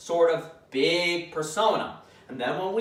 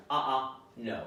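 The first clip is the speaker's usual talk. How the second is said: low, soft firm uh-uh no; quiet assertive correction; calm assertive correction; 4 semitones lower, 6 dB quieter than usual. Shown as a demonstration. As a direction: correct the dog calmly but firmly. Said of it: "calm, assertive correction".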